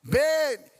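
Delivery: calling out 'Beni' loud and high from outside; a kid outside shouting for Beni, loud and high; retold shout. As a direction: yell the boy's name from down the street. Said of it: "Eu ouço alguém gritando".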